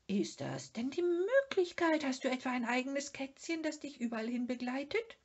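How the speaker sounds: high, fast, astonished question; a lightly voiced friend gaping in wonder; amazed Moomin. Moomin marvels at Sniff's own kitten, high and amazed.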